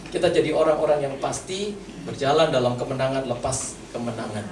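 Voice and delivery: normal talking voice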